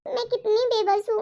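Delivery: ordinary talk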